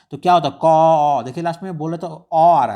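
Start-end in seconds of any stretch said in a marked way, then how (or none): 0.6-1.2 क said alone, loud and drawn so the inherent अ is heard at the end; teacher sounds out क to show the vowel अ at its end; letter sound demo. Shown as a demonstration. Teacher shows students the अ hidden in क, loud letter demo. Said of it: "अगर हम बोलते हैं क".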